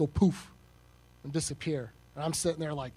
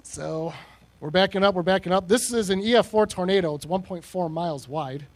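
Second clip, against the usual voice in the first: normal talking voice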